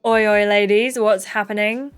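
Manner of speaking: ordinary delivery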